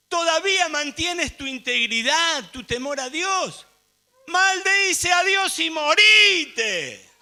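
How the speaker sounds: high, loud, scornful wife; Job's wife. Acted. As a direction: build from reproach to a drawn-out shout. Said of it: "Esta sí es de la mujer".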